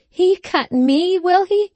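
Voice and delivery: very high, slow indignant cry; outraged scream, acted. A narrator's reading. Aunt rages at being cut, high drawn-out mock scream. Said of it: "trembled with rage and disappointment".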